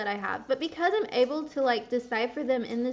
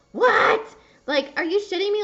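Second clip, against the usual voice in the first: replayed shock, high and loud; shocked disbelief; 8 semitones higher, 8 dB louder than usual. Retelling her reaction to the therapist, in loud high disbelief. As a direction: she relives hearing the therapist's explanation.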